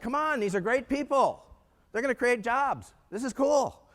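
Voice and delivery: high, stretched-out pep talk; rally them with high, drawn-out excitement; retold enthusiasm. Happy new hire cheering on gloomy colleagues, high-pitched enthusiasm.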